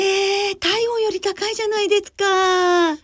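high, drawn-out disbelief; real amazed reaction, slowed and lifted; surprised. Real, not acted. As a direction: stretch it out in high-pitched astonishment. Reacts to a 37-degree forecast.

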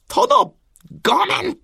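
high, pained retainer cry; anguished cry. Acted. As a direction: cry out as you strike. Retainer strikes the lord. (悲痛な叫び)

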